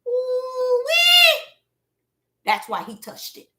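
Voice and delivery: ordinary delivery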